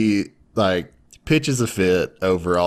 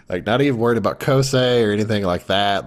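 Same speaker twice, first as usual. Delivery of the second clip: usual speaking voice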